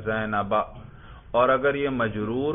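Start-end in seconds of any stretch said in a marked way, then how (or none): 0.0-0.6 زینبہ with a clear final a sound, no nunation; audible fatha ending. Shown as a demonstration. Teacher to student, showing the accusative ending clearly. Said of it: "دیکھو نصب پہ ہم تلفظ کر رہے ہیں".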